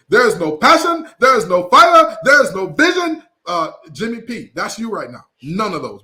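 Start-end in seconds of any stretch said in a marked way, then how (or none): 0.1-3.2 high, loud, slowing dramatic chant; imitating the TikTok sound. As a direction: ham up the viral TikTok rant. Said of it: "like the sound on TikTok says".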